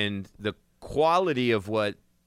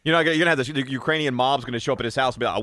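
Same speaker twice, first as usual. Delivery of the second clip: ordinary talk, nothing marked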